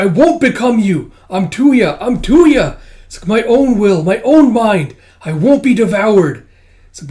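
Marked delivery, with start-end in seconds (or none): 0.0-2.8 high, loud desperate protest; defiant shouting. Acted. Tuya refusing to become the Baroness, shouting. 3.3-6.5 high, loud acted protest; defiant shouting. Tuya fighting being devoured, defiant shout.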